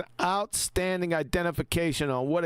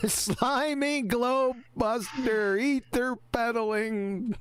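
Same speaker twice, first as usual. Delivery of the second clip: laughing mimicry; 7 semitones higher, much slower than usual. Mocking the caller's insult.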